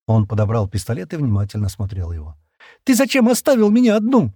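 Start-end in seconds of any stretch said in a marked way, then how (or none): 2.8-4.3 much higher, louder tearful cry; shouting through tears. Acted. Shira cries out at Stan through tears, tearful shout. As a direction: cry it out, sobbing at him. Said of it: "сквозь слёзы крикнула Шира".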